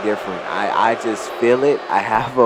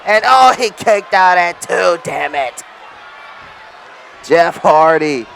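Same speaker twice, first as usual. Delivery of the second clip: excited wrestling play-by-play, higher and louder; wrestling commentator; 5 semitones higher, 5 dB louder than usual. Acted. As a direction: call the match like a ringside announcer. Live play-by-play of game match.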